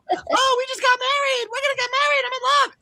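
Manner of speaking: very high, airy, lovestruck mock girl voice; giddy Anna. Mocking Anna to his friend, very high giddy girl voice. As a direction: squeal it like a dizzy young bride. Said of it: "she's like".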